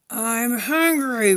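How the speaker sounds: slow, drawn-out growly hunger; roaring lion. Acted. Lion roaring about his hunger on the path. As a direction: stretch it out like a hungry beast. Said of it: "roared the lion".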